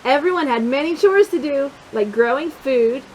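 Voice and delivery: ordinary delivery, nothing marked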